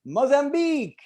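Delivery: high, bluffing foreign word; mock foreign answer said high; vaguely foreign reply. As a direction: toss back anything foreign-sounding. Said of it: "and you're like".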